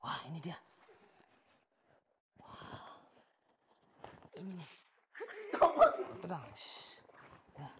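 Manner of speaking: hushed suspense. Acted. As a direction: whisper as if the bomb is right there. Tense whispered bomb search.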